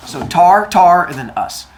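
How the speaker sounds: "tar, tar" as two separate stressed syllables, then "us"; syllable-by-syllable pronunciation. Shown as a demonstration. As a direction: break it up: "tar", "tar", "us". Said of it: "Tartarus is like tartar sauce".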